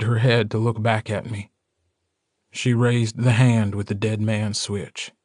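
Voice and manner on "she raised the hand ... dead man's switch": ordinary delivery, nothing marked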